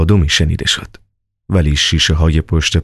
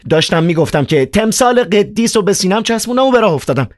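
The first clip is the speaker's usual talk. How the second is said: put-on much higher, agitated young man; Ivan's voice. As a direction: play Ivan, high and worked up. Narrator voices Ivan.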